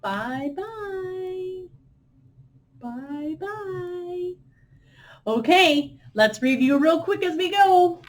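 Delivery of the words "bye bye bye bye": soft high bye-bye; soft little wave goodbye. Shown as a demonstration. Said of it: "two soft things we say".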